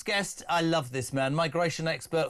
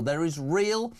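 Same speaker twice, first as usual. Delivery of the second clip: ordinary talk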